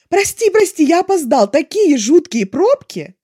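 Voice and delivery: a whirlwind tumbling into his seat apologising; breathless apology. A narrator's reading. Latecomer apologises to his date, loud and excited. Late guy bursts in.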